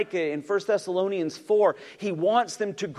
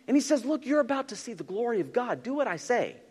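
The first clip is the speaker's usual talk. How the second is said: fiery preaching; 8 semitones higher than usual. Acted. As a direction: preach it with mounting fire. Preacher building to full force.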